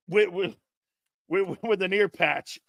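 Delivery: ordinary talk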